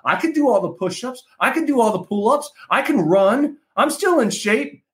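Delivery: high, fast, incredulous protest; mock outrage at being called old; indignant protest. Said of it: "I was like".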